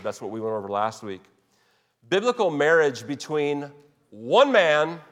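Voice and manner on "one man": "one man" drawn out slowly with heavy stress; emphatic stress. Real, not acted. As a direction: lean hard and slow on "one man". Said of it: "notice how I'm, I'm putting emphasis".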